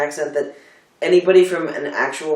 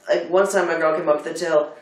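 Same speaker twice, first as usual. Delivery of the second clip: usual speaking voice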